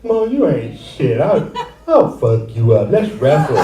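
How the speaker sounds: mock tough-kid challenge; imitating friend Leon. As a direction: lightly put on a friend spoiling for a fight. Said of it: "he'd come up to me like".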